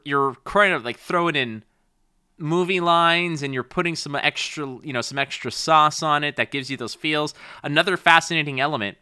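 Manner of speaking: ordinary delivery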